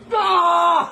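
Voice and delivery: pained yelp. Real, not acted. Yelp during rough massage.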